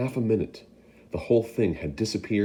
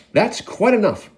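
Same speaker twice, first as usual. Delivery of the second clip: higher and louder command; shouted; 12 semitones higher, 7 dB louder than usual. Acted. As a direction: break it up. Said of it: "the fat shopkeeper shouted".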